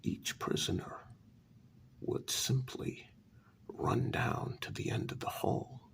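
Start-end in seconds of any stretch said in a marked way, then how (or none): none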